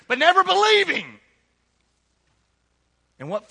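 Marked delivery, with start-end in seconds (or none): 0.1-1.0 high, loud cry of never believing; preaching shout. Real, not acted. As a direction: lift it to a full-voiced shout.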